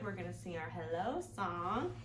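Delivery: plain reading voice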